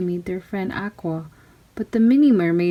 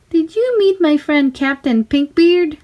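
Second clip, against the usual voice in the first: much higher, louder sweet call; mermaid calling up; 7 semitones higher, 4 dB louder than usual. Acted. Little mermaid calling to her friends on the ship, high cheerful call. Aqua continues.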